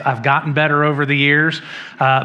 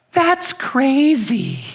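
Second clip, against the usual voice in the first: much higher, slow "That's crazy"; different way; 9 semitones higher, much slower than usual. Retold or imitated. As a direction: squeak it high and draw it out. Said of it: "I'm not gonna say it that way".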